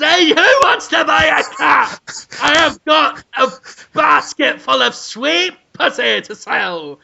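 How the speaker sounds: high, loud street-seller cry. Pretend. Cat seller hawks a basketful to passers-by, loud mock cry. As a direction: call out your wares in a hammed-up shout. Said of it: "[name] selling cats".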